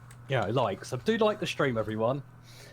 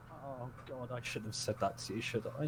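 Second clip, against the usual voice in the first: imitated regret; 10 dB quieter than usual. Mother regretting what she told her son, imitated regret. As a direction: drop it to a soft, sheepish aside. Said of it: "who was, like, going".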